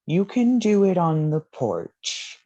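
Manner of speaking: put-on high, slow old butler voice; very old man. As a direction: stiff elderly butler offering the porch. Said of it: "It's a very old man".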